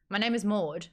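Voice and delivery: ordinary talk, nothing marked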